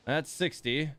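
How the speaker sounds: ordinary talk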